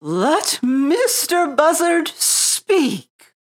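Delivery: high, slow, commanding owl. A narrator's reading. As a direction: speak as the owl giving the floor. Owl gives the vulture the floor.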